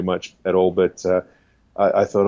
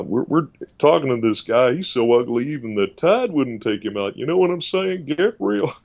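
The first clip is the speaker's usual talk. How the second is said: Doctor Phil impression; 4 semitones higher than usual. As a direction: lean into the Texan sayings. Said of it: "all these Texan sayings".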